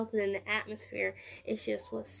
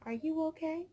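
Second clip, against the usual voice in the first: gentle asking; 6 semitones higher, 4 dB quieter than usual. Retold or imitated. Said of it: "I'm like".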